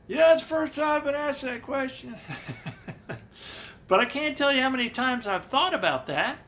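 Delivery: hammed-up Fenn impression, high and loud. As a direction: imitate him answering at full, excited volume. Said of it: "in a more youthful voice".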